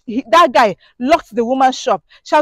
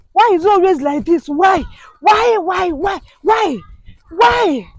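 exasperated shout; 6 semitones higher than usual. Real, not acted. Fed-up woman crying out at abusive men, shouting. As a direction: throw each why out in despair.

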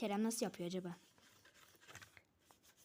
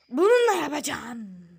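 shouted announcement; 2 semitones higher, 12 dB louder than usual. Real, not acted. Child telling viewers which tool to use, shouted announcement. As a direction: blurt it out loud, stretching the first word.

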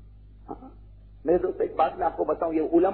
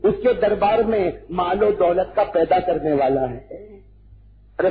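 ordinary delivery

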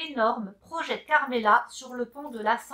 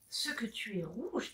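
shy whisper; 11 dB quieter than usual. Acted. Shy admiring remark.

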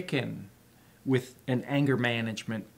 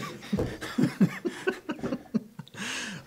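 laughing; 11 semitones higher, 3 dB quieter than usual. Real, not acted. Laughing at own joke.

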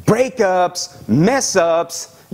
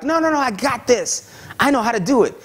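put-on higher, cocky kid voice; eager kid; 7 semitones higher than usual. Child learning to ride a bike, higher and cocky. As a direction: brush off the coach like a cocky kid. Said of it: "but they're like".

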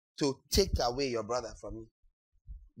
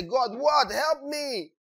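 higher pitched cry to God; exasperated plea to God, pitched up; 9 semitones higher, slower than usual. Retold or imitated. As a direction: throw up your hands and plead. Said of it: "you finally say".

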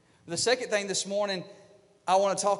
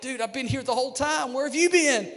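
higher and louder, playful exasperation; a casual "Dude" from an impatient God; mock-frustrated God asking where we've been; exasperated God; 7 semitones higher, 5 dB louder than usual.

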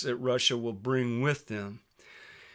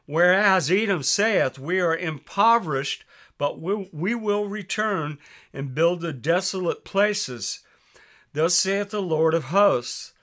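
raised, louder preaching read of the Bible; scripture reading; 3 semitones higher, 4 dB louder than usual. Acted. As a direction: proclaim the verses like a preacher.

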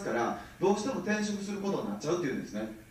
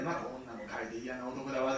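gossiping auntie; 4 semitones lower, 7 dB quieter than usual. Retold or imitated. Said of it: "とかやってるんですよ".

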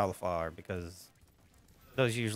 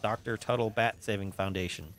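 normal talking voice

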